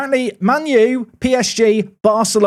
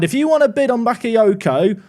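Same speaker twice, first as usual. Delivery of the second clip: ordinary delivery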